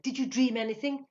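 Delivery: high, eager question; begging. A narrator's reading. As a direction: plead urgently to be told. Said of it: "he begged".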